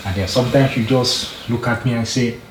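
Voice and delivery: normal talking voice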